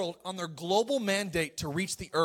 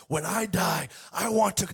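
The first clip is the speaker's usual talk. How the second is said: Reinhard Bonnke. Retold or imitated. Preacher quoting the late evangelist's wish, German accent. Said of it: "in his German accent".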